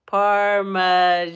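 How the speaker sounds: loud, drawn-out syllable by syllable; sounding it out. Real, not acted. Host reading out the cheese name, sounding it out. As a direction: stretch the word slowly and loudly, breaking before the last syllable.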